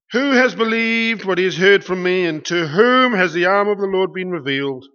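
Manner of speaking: loud, proclaiming read of Isaiah; scripture reading. Acted. Preacher reading Isaiah 53 to the congregation, loud proclaiming. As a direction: proclaim the verse to the room.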